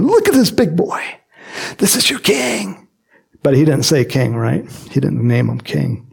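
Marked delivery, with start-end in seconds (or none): 0.0-2.9 high, hyping presenter; showing off. Retold or imitated. Samuel hyping Saul to the crowd, high and proud. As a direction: present him like a prize. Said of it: "just saying".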